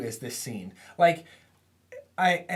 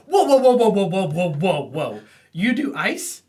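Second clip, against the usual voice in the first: amazed Bobby; 3 semitones higher, 8 dB louder than usual. Retold or imitated. Bobby sees Idy shoot ice, loud astonished whoas. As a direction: gasp in wonder at a fellow ice-maker.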